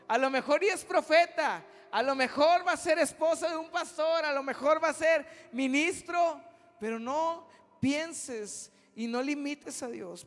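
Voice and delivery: loud, high, fervent preacher's cry; fiery preaching. Acted. Preacher crying out to God before the youth, loud and fervent. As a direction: hammer it home like a revival preacher.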